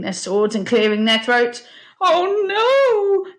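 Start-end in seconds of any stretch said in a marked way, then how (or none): 2.0-3.3 high, alarmed wail; yelp. A narrator's reading. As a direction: yelp out in comic dread. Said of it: "yelped Mungo".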